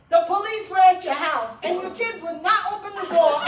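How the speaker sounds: loud, high, laughing report of the police at the door; excited retelling. Retold or imitated. Neighbor bursting in to tell her the news, excited and loud. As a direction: blurt the news, half laughing.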